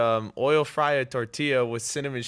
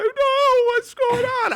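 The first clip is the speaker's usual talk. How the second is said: very high-pitched acted child's wail; mock crying; 6 dB louder, much slower than usual. Kid crying after stomping the mole, retold mock wail. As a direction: imitate a panicked little kid crying. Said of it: "I start crying. I'm like".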